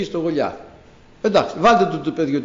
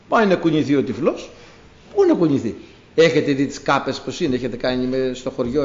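ordinary talk, nothing marked

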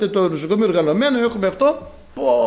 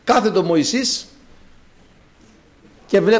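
usual speaking voice

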